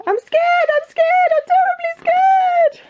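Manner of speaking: very high repeated squeal, stretching at the end; terrified cook. Acted. Cook declaring his fear to the king, squealing in terror. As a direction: build a hammed-up wail of fear.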